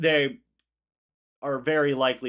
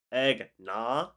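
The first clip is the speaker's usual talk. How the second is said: usual speaking voice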